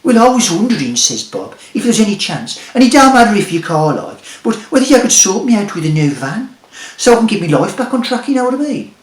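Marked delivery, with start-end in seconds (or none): 0.0-0.9 higher, louder timid ask; high Bob voice. Acted. Bob speaks. 1.7-8.9 higher, louder timid ask; high Bob voice. Acted. Bob speaks.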